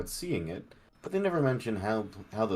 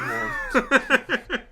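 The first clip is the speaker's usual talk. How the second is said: high laughing rogue voice; 14 semitones higher, 6 dB louder than usual. Acted. Rogue persona laughing at itself.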